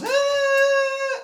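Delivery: high, loud "ah" squeal; mock kid squeal. Friend's little brother bursting in on gamers, mock squeal. Imitates a naked kid's cry.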